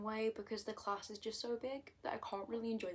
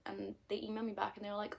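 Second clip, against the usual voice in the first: normal talking voice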